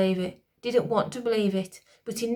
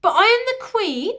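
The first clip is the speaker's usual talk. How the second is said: high, loud outraged queen; queen demanding; 12 semitones higher, 8 dB louder than usual. A narrator's reading. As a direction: assert your rank in outrage. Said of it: "she demanded".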